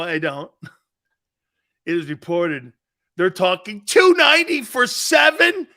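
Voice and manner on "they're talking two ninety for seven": high and loud, stunned by the number; shocked disbelief. Real, not acted. Host reacts to a huge contract report, loud and stunned. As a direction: blurt the figure out in disbelief.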